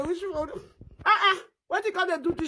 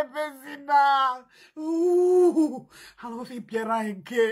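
usual speaking voice

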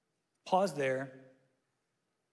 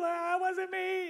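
fake innocent; 13 semitones higher than usual.

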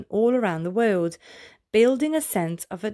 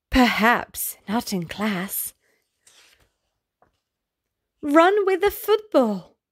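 lively, bouncy read-aloud of a rhyming list; storybook reading; 4 semitones higher, 4 dB louder, slower than usual. A narrator's reading. Reading a running picture book aloud to children, lively storybook voice. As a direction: read it like a game for small kids.

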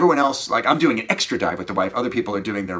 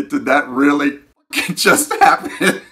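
louder, slow, incredulous laugh in the voice; mock disbelief; 5 semitones higher, 7 dB louder than usual. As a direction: act out stunned exasperation. Retelling his exasperated reaction.

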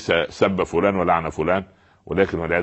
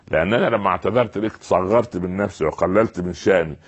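ordinary delivery

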